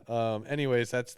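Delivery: ordinary delivery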